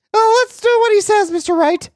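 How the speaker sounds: very high, loud sidekick voice; high girl voice. Acted. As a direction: pitch way up, cheerful. Voicing Maya.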